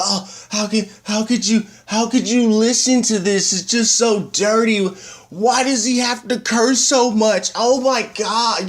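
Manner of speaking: high, drawn-out whining imitation; mock scandalized mom. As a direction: play an appalled mother clutching her pearls. Mimics disapproving mom complaining.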